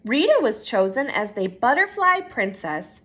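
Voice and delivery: ordinary delivery, nothing marked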